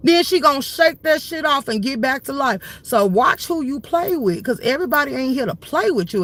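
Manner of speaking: normal talking voice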